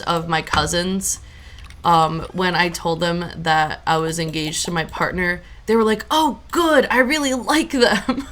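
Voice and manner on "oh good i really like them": high, pleased retold approval; delighted cousin. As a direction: imitate a young relative happily approving, ending in a laugh. Retold happy reaction of young cousin.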